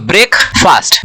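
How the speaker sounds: "ब्रेकफास्ट" as two separate words, break + fast; wrong pronunciation. Shown as a demonstration. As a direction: give "ब्रेकफास्ट" a full long "faast". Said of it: "ये गलत pronunciation है". ("ब्रेकफास्ट" को गलत ढंग से ब्रेक-फ़ास्ट बोलें)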